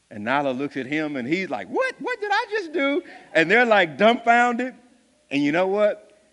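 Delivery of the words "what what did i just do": very high, astonished little voice; stunned surprise. Acted. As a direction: gasp it out in disbelief. Nala stunned after roar.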